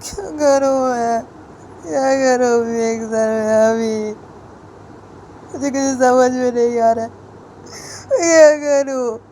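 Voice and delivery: high, drawn-out tearful wail; acted crying. A narrator's reading. Student weeps to his teacher that he is lost, crying voice. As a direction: wail helplessly, each phrase sliding downward. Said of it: "समर मुझे रोते होते ऐसे बोलता है".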